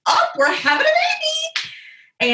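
high, drawn-out mock astonishment; stretched-out, high-pitched surprise; surprised exclamation. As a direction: imitate a doctor's delighted discovery, slow and squeaky. Imitates doctor's surprise at birth.

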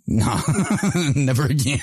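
real laughter breaking into the words; laughing refusal. Real, not acted. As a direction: refuse it while cracking up. Laughing at own bad memory.

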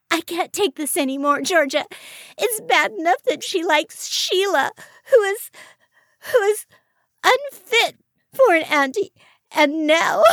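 high, loud, halting tirade near tears; distraught rant. A narrator's reading. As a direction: rant until the words start to break into sobs. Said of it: "she continued her rant".

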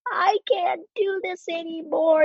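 high, slow, tearful plea to her husband; replaying a breaking plea, slowed right down; retold tearful plea. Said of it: "I called my husband".

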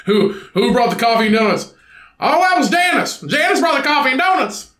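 high, silly meeting voices; imagining koalas gossiping over donuts; mock support-group chatter.

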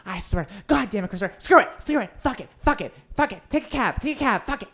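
high mock exasperated ranting; mom giving up. Mom giving up in high exasperated rant. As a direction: throw up hands like mom. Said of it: "going back and forth".

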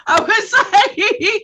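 high, slow laugh-through; words dissolving into big laughter; cracking up while talking. Real, not acted. Cracking up mid-story.

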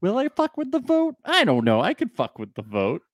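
high, sly voice of a scheming little devil; mischievous little devil. Retold or imitated. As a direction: play it like a bored imp tempted to meddle. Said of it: "and he's like".

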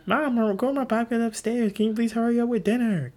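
bratty demand. Retold or imitated. Bossing mom about dinner for his podcast. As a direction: demand it like a spoiled kid. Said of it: "telling my parents what to do".

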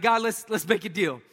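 hammed-up deal-making prayer; a touch of a pleading young man praying; mock bargaining prayer. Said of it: "I was like".

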